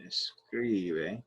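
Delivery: "escribe" stretched very slowly and softly; drawn out. Shown as a demonstration. Teacher writing the command out for students, "escribe" slowly drawn out. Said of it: "write this a couple times here".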